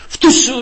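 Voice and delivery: loud high spitting sound; imitated spit. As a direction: spit it out like the boss did. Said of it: "ρε, μου λέει".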